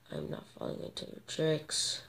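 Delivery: sly whispered taunt; voiceless cunning whisper. Real, not acted. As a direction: hiss it under your breath.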